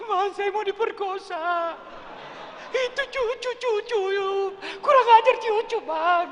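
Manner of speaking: high, loud, hammed-up wailing woman; hysterical accusation. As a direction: fake a tearful accusation. Suleha fake crying accusation. (istri pura-pura menangis menuduh Yusuf, suara tinggi melengking)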